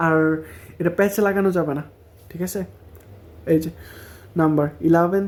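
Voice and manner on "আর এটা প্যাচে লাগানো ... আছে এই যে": normal talking voice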